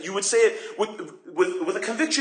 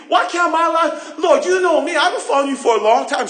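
high, loud whine with a laugh; mock protest to the Lord; whining to God; 9 semitones higher, 5 dB louder than usual. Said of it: "And you start complaining".

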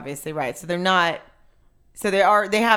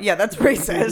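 usual speaking voice

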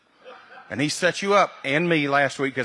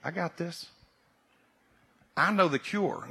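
much softer, calm and unhurried; calm reassurance; 6 dB quieter than usual. Pretend. Holy Spirit calmly answers the fussing believer, softly reassuring. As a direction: quietly settle every worry. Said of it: "the Holy Spirit very calmly is saying".

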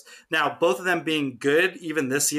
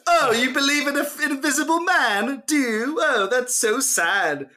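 mock British sneer; 10 semitones higher than usual. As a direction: taunt a believer in a smug British accent. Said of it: "that was a great accent".

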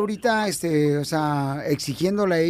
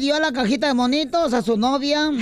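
high, sing-song gossip report; pitched-up chatty tattle; excited gossip; 8 semitones higher than usual. Acted. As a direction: dish the juicy rumor to the host. Opens gossip segment.

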